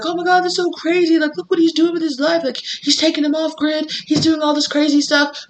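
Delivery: amazed onlookers. Retold or imitated. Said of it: "everybody's like".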